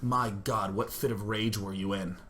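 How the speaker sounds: low, hushed disbelief; astonished doctor. Retold or imitated. Doctor questioning injured patient, astonished doctor. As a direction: voice a doctor quietly taken aback.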